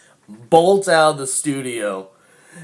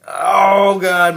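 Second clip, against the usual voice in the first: higher, louder "oh God" in dismay; mock horror; 7 semitones higher, 7 dB louder than usual. Friend seeing the cops arrive, mock horrified. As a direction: groan it out in hammed-up panic. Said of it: "It's like".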